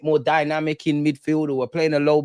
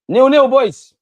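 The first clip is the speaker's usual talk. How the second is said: excited shout; 10 semitones higher, 12 dB louder, slower than usual. Real, not acted. Celebrating the score.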